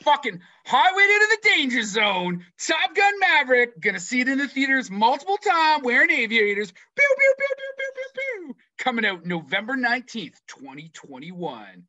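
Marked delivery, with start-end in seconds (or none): 0.0-6.7 higher, louder, near-shouted fan hype; hyped-up excitement. Real, not acted. Host hypes Top Gun Maverick to co-host, loud and excited. 6.7-8.5 much higher playful laser-gun pews, fading out; pew pew gun sounds. Pretend. Host mimics fighter jet guns for Top Gun, playful pews.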